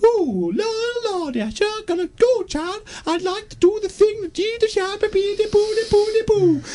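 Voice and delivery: very high, wailing parody voice; mock sentimental babble. Mocking the director's sentimental films.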